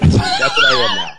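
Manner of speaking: high shout through a laugh. Real, not acted. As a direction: yell it out while cracking up. Cheese sandwich bit, laughing shout.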